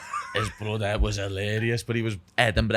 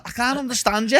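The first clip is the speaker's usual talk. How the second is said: high, louder imitation of baffled missus; mock bewilderment; 13 semitones higher, 5 dB louder than usual. Imitating his missus failing to follow his friend, high mock bewilderment. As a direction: pitch it right up, baffled. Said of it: "she was like".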